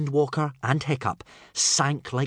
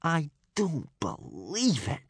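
slow, drawn-out higher groan; dragged-out, dismayed moan; disbelieving groan; 4 semitones higher, 3 dB quieter, slower than usual. Acted. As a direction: groan each word in disbelief. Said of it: "groaned Snotlout".

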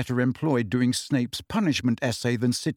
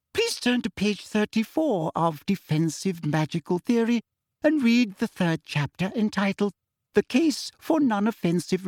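high, sugary sing-song teacher; sickly sweet greeting; Umbridge; 5 semitones higher than usual. A narrator's reading. Umbridge greeting her class, high and sugary.

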